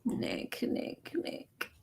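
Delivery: breathy disbelieving whisper of his name; exasperated whisper. Real, not acted. Reacting to Nick's clip, whispered exasperation. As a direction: whisper it like you can't believe him. Groaning at interviewee's naive claim.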